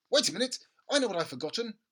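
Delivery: put-on a boy suddenly remembering, fast. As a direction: blurt it out as it comes to you. Boy voiced in story.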